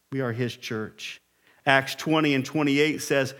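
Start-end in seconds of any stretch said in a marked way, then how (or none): 0.1-1.2 dropped low and soft, "We are his church."; quiet repeat. Shown as a demonstration. Pastor repeats to the congregation, now soft and low. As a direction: say it again softly and lower.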